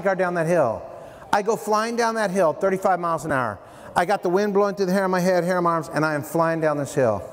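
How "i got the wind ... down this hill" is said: ordinary talk, nothing marked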